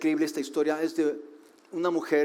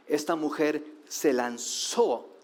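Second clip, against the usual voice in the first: usual speaking voice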